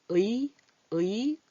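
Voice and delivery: 의 drawn out very slowly, lips spread; slow careful 의. Shown as a demonstration. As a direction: stretch 의 into a slow glide from 으 to 이. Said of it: "이렇게 소리가 나야 되는데".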